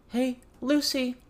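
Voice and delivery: put-on high, slow girlish call through a door; Avery raising her voice. A narrator's reading. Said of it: "Avery raised her voice".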